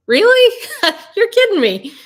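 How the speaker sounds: high, loud amazed cry; really, you're kidding me, high and loud; astonished. Retold or imitated. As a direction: shriek in delighted disbelief. Retold astonished reply.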